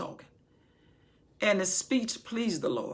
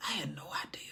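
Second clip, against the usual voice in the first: breathy awed whisper; whispered disbelief; 3 semitones lower, 8 dB quieter, much faster than usual. Acted. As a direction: hush it, overwhelmed. Awed aside as Solomon.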